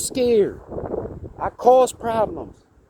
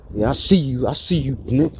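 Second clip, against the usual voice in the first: fake tough threat; 6 semitones lower than usual. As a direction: drop the voice low and pretend to menace. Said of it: "Like that. ... like a threat".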